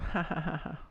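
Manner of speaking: high-pitched playful fake giggle; put-on giggle. Giggly put-on noises at spawn.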